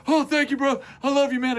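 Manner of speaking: put-on high grateful kid voice; a rescued little kid gushing thanks; eight-year-old voice. Rescued boy thanking his friend, high kid voice. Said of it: "doing my best eight-year-old impression".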